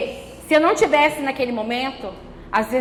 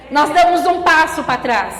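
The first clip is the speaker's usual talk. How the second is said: normal talking voice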